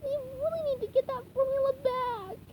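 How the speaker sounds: determined SpongeBob. Acted. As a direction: urge it eagerly. SpongeBob voiced in story.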